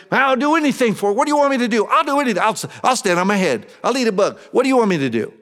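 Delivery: high, loud, fast eager plea; eager new believer. Acted. New convert begging Jesus for a task, high eager gush.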